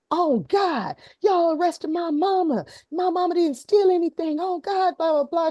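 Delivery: put-on high, loud frantic child's yell; imitating a yelling girl. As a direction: mimic a distraught little girl screaming at police. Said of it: "She's cussing, she's yelling up a storm".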